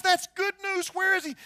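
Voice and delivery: high, eager royal outburst; delighted king. Acted. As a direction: jump up in pitch with sudden joy. King delighted at the servant's news.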